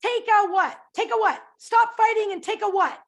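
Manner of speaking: put-on high, loud call to squabbling kids; scolding grandmother. Grandmother to fighting children, high loud scolding. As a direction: raise your voice like grandma breaking up a fight. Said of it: "your grandmother would say".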